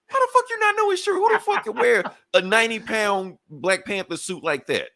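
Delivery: high-pitched, disbelieving rant; exasperated, fast, shooting up in pitch; incredulous outburst. Acted. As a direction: fire it back, baffled that anyone doubts it. Disbelieving retort to doubters.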